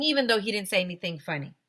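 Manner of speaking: usual speaking voice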